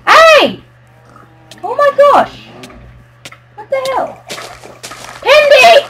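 high, loud shocked yelps; real shock, voice jumping high; shocked yelling. Real, not acted. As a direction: yell in disbelief at the chaos. Friend griefing, shocked yelling.

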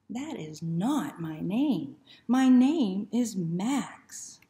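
slow, loud insistence; indignant dog. Acted. The dog corrects the book, slow and loud. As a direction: spell it out, fed up.

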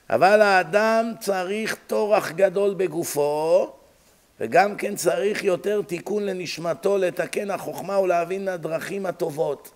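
rapid Hebrew reading, starting loud; fast, steady read-out with an emphatic opening; sermon text recitation. Acted. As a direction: recite the religious text briskly. Reads Hebrew text aloud.

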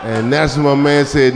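ordinary talk

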